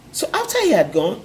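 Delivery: usual speaking voice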